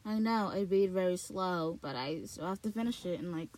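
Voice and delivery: ordinary talk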